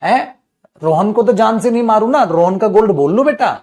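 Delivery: loud, high teacher scolding; scolding shout. Real, not acted. Teacher scolding kid in class.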